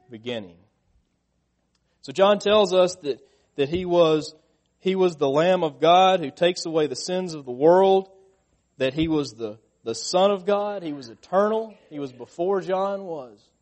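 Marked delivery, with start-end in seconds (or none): 2.0-13.5 higher, louder fervent sermon; preaching at full force. Acted. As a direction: preach it out to the congregation.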